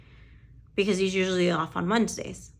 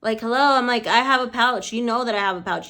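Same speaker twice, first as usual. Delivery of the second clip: indignant retort; 3 semitones higher, 6 dB louder than usual. Retold or imitated. Acted indignant reply to absent man.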